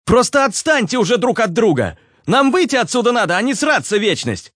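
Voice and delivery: high-pitched, fed-up begging; exasperated pleading. A narrator's reading. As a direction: plead with bickering companions to stop. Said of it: "Взмолился Руслан". (высокий, умоляющий, раздражённый голос)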